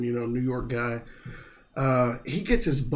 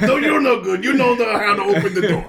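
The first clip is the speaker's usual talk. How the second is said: mock dog scolding; 6 semitones higher, 7 dB louder than usual.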